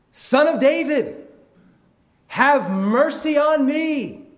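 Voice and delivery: drawn-out, high, full-force shout; crying out harder. Acted. Blind man defies the hushing crowd, crying out harder. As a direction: bellow the plea slowly at a high pitch. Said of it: "he cries out all the more".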